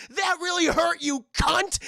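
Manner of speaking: high, loud furious yell; angry shout. Retold or imitated. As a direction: scream it in outrage. Villain hurt and furious.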